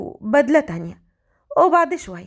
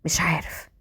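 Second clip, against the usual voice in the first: dazed whisper; 6 semitones lower, 6 dB quieter than usual. A narrator's reading. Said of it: "فهد بتوهان".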